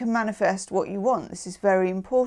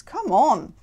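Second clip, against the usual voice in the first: ordinary talk, nothing marked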